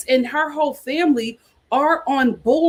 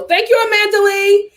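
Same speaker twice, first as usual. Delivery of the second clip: excited thanks; 6 semitones higher, 5 dB louder than usual. Real, not acted. Thanking a donor on stream.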